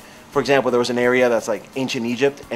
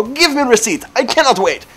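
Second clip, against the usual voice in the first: put-on high, loud Russian-accented demand; mock Russian accent; 11 semitones higher, 6 dB louder than usual. Joker trying the bit on a supermarket cashier, Russian accent. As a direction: bark it like an impatient Russian customer. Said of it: "saying things in that accent".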